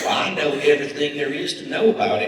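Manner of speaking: bragging in a put-on Southern accent; bad Southern accent. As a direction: play a loud Southern lady one-upping her friend. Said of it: "Bad accent".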